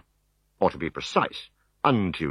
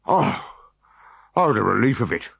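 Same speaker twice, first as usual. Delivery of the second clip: gasped; 3 semitones higher than usual. Acted. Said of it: "gasped the Decurion".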